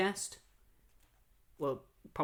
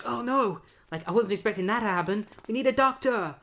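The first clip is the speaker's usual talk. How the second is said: panicked Kyle; 7 semitones higher, 4 dB louder than usual. Retold or imitated. Kyle panicking after bad shot.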